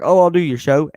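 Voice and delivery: loud, mocking flaky friend promising; a loud sarcastic impression of a friend who flakes; mock excuse voice. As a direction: ham up the empty promise. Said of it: "I get more mad when somebody's like".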